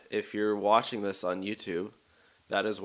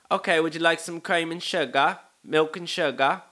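high, loud mock British hostess; horrible British accent; 8 semitones higher, 7 dB louder than usual. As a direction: offer it in a badly faked British accent. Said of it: "sorry for this horrible accent".

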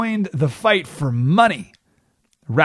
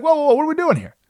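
much higher startled whoa; alarmed rally; 13 semitones higher, much faster than usual. Retold or imitated. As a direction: snap the men out of it, pitched high. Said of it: "And he's like".

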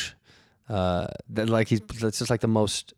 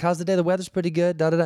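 higher, breezy small-talk patter; loose and chatty; 7 semitones higher than usual. Acted. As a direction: rattle off mock friendly small talk. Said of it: "like, loose and chatty".

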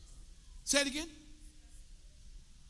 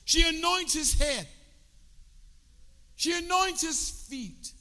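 preaching, proclaiming; 7 semitones higher, 6 dB louder than usual. Acted.

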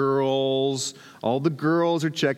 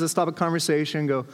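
ordinary talk

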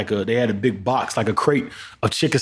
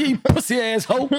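mock insult; 10 semitones higher than usual. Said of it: "I said".

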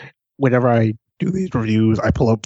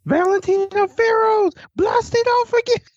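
much higher mock announcer call, slow; hammy wrestler shout. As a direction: belt out a cartoonish wrestling callout.

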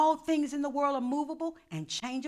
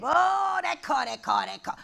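mock craving; 5 semitones higher, 15 dB louder than usual. Mocking greedy longing.

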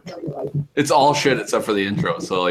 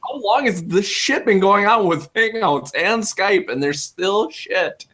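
exasperated rant; 10 semitones higher than usual. Real, not acted. Ranting about Hangouts and Skype.

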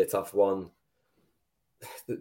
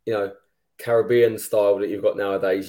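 ordinary talk

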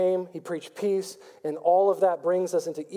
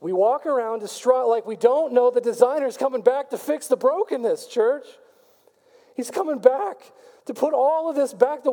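higher-pitched full-force preaching, building; fired-up preaching; 5 semitones higher than usual. Acted. Pastor rallies the church, fired-up preaching. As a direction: preach it with rising fire. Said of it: "Man, I'm getting fired up".

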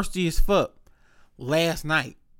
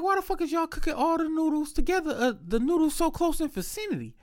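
exasperated; 10 semitones higher, 5 dB quieter than usual. Retold or imitated.

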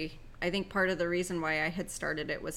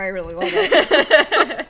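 higher, drawn-out and laughing hard; 7 semitones higher, 14 dB louder, slower than usual. Real, not acted. Admitting the coworker was right, laughing. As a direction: break into loud laughter while saying it.

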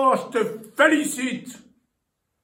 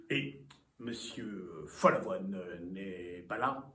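haughty aristocratic french; 13 dB quieter, slower than usual. Acted. Visitor asks for the host.

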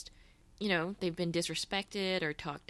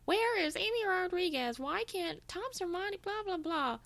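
high, far-off mocking rant; mocking fan rant; 12 semitones higher than usual. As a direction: whine the questions in a high voice. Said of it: "heard us going".

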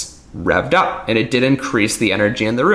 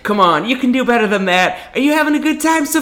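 hype host; 11 semitones higher than usual. Retold or imitated. Magician mocking a hype host's opener, pumped-up emcee voice. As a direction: work the crowd like a cheesy entertainer. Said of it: "I'd be like".

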